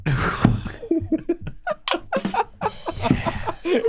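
high crying laughter; helpless high wheezing laugh. Real, not acted. Losing it after joke.

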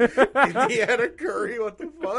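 words bursting out through laughter; laughing. Real, not acted. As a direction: crack up mid-sentence. Friends cracking up over a joke.

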